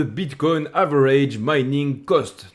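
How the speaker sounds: slow, careful show-off English accent. Shown as a demonstration. Showing off his English accent to his viewers. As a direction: roll out the English term in a proud English accent. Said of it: "j'ai progressé avec mon accent anglais".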